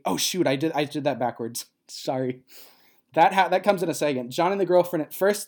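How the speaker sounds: usual speaking voice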